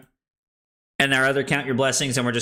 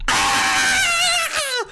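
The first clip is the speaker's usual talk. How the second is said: high, loud hype shout; excited yell; 4 dB louder than usual. Real, not acted. Streamer hyped about back-to-back turns, yelling. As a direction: let out a triumphant yell.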